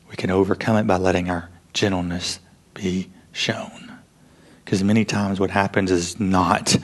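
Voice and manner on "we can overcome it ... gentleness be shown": soft whispered encouragement; tender whispered reassurance. Real, not acted. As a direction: hush it down, gently. Gentle close of sermon point.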